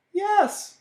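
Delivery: very high-pitched little yes; high childlike squeal of agreement; eager child's voice. A narrator's reading. Baby answers eagerly.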